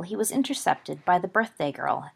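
usual speaking voice

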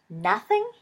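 loud dismayed outburst; dismayed cry. A narrator's reading. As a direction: cry out in disbelief. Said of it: "he cried".

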